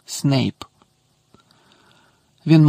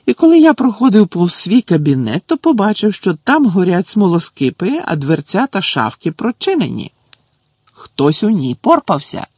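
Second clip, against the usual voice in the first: loud, high-pitched outraged account; indignant; 5 semitones higher, 4 dB louder than usual. A narrator's reading. Professor telling caretaker his office was searched, outraged. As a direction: report it with raised, indignant force.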